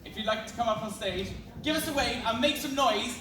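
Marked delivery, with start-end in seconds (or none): 1.6-3.2 calling out to crowd. Real, not acted. Host rallying the audience.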